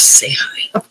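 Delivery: whispered, soft reassurance. Real, not acted. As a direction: hush it kindly to a shy fan. Reassuring a shy fan.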